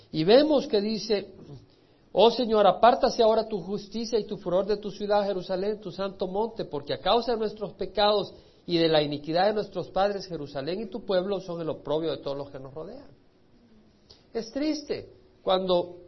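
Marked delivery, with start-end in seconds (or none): 2.1-13.1 loud, pleading proclamation of scripture; louder preacher's prayer voice; fervent prayer reading. Acted. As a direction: cry the plea out to God from the pulpit. Reads Daniel's plea again.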